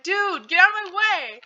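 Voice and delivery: high, loud retold outburst; acted-out "move it" yell; mock angry order. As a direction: order a friend aside, exasperated.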